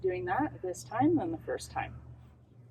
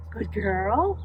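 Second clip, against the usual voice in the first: usual speaking voice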